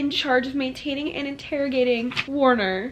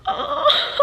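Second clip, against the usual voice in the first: very high squealing cry; delighted squeal; 6 dB louder than usual. Real, not acted. Reader squealing over Warner's dimples, very high.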